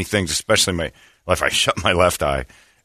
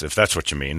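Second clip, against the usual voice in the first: usual speaking voice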